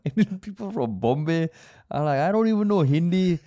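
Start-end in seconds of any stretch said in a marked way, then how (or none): 2.2-3.4 higher, louder mock Indian accent with a laugh; laughing mock Indian accent, carefree. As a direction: imitate the Bombay friend in an Indian accent. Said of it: "I will use the accent because I'm Indian".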